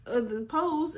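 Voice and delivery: ordinary talk, nothing marked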